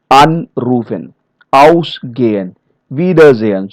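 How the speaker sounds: "Anrufen, ausgehen, wiedersehen" slowly, with long AN, AUS, WIE; stressed prefix. Shown as a demonstration. Trainer repeating separable verb examples, slow stressed prefix. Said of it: "the stress is on the prefix part".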